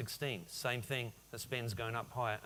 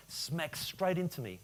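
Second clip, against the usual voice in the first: ordinary delivery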